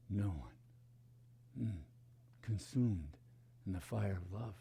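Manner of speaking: slow, low, devotional reading over music; poem recitation. Acted. Spiritual teacher reciting a poem to students, slow and reverent. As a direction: let each image burn slowly, fading to a hush.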